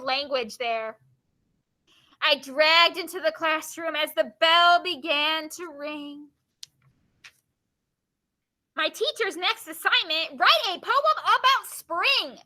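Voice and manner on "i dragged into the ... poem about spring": slow, rhythmic verse lifting at the end; poem reading. Acted. Teacher reading a poem to the class, slow dramatic recital. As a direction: draw out the lines, then announce the assignment.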